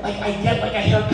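ordinary talk

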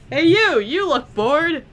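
high, loud playful hail; calling out. Pretend. Calling out to game character.

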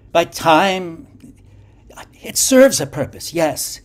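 high, rising intensity; impassioned. A narrator's reading. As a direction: build to an impassioned peak. Impassioned mystical explanation.

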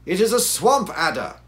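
loud, high cry of alarm. Acted. Holmes on seeing the loathsome serpent, crying out. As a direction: cry out the discovery forcefully. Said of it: "cried Holmes".

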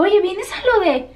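high, drawn-out curious question; acted eager question. Asking her friend about the same event, high acted eager question. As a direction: re-enact a hopeful, excited ask. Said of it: "hasta se los voy a actuar".

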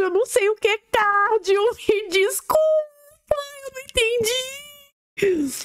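high, drawn-out fake lament; hammed-up wail climbing very high and slowing; mock wailing. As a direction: wail an apology in exaggerated distress. Acted mock-hurt apology over "cardio.

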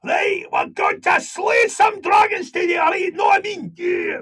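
full-on high-pitched Glaswegian warrior shout; heavy Glaswegian Scottish. Shown as a demonstration. Actor auditioning as dragon slayer, full-on Glaswegian shout. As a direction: really give it heavy Glasgow Scots, shouted high. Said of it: "really giving it, you know, full on heavy sort of Glaswegian Scottish".

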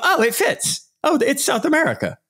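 high, sing-song fake revelation; mock discovery. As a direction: play a sarcastic aha moment.

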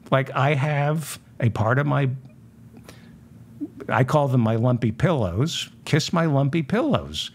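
usual speaking voice

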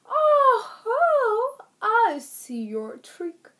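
high, loud, crying little-man voice; wailing leprechaun. Acted. Leprechaun moaning at Pet's trick, high wailing voice. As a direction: moan it like a beaten fairy.

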